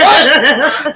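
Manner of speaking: mock disbelief. Acts out his reaction in story.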